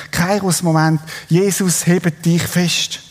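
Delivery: ordinary talk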